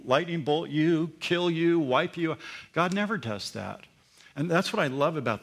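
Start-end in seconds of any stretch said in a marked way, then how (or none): none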